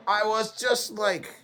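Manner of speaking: ordinary talk, nothing marked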